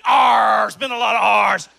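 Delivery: gruff pirate growl. Pretend. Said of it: "In my best pirate voice".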